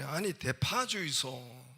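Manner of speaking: slow, insistent Gyeongsang request; Gyeongsang dialect. Acted. Young customer insisting to the owner, slow Gyeongsang dialect. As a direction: insist with drawn-out Gyeongsang vowels. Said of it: "이 경상도 청년이".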